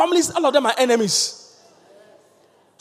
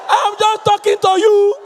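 loud, high shout that he isn't shouting; shouting denial; 9 semitones higher, 7 dB louder, slower than usual. Retold or imitated. Husband insisting to wife he isn't shouting, loud shout. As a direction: bellow that this is just talking. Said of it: "He said".

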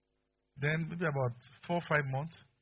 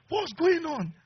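high, amused question; husband baffled again; high mock husband; 4 dB louder, much slower than usual. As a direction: ask it with a laugh in the voice.

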